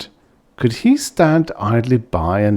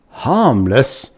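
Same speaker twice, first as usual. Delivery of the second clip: astonished echo; 6 semitones higher, 4 dB louder than usual. Acted. Ludovic astonished at the Belfin.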